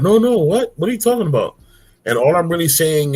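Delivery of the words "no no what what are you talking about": baffled. Retold or imitated. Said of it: "They'd be like".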